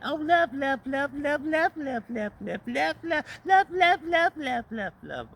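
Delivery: frantic, very high-pitched mock begging; mock pleading. Ranting speaker mocks a book begging for its life, frantic mock pleading. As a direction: chant the word as a desperate, squealing plea. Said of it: "begging and pleading for its life".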